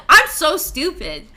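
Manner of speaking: usual speaking voice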